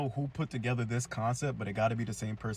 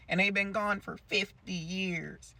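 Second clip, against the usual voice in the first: much higher, drawn-out teacher's lament; crazed Miss Keen; 10 semitones higher, 5 dB louder, much slower than usual. Acted. Broken teacher on how long they were gone, slow high wail. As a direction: stretch every word into an unhinged wail. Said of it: "She goes".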